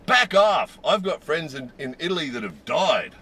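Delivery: mock-furious warning to keep away; retold angry shout. Angry shopper scolding strangers in a store, imitated outburst. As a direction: imitate her high, loud yell, easing after the first words. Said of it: "she said this really loudly".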